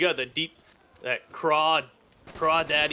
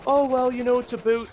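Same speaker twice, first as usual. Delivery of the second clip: high, louder mock Canadian "a boot"; mimicking mother's accent; 8 semitones higher, 4 dB louder than usual. Imitating mother talking to her daughter, high mock Canadian accent. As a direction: tease how mom says "a boot". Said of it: "my mother said".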